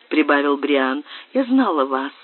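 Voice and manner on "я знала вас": plain reading voice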